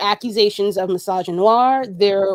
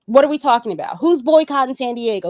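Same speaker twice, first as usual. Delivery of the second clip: normal talking voice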